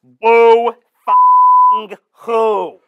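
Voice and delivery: drawn out, very loud sneer; mocking shout. Mentor mocks the host's self-pity, loud and drawn out. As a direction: stretch it out, mocking him hard.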